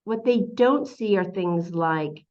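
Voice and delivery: usual speaking voice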